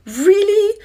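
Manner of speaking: high, loud, rising "really?"; incredulous. Real, not acted. Fed up after being shoved in street, incredulous. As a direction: let disbelief shoot the pitch up. Disbelief at the men's behaviour.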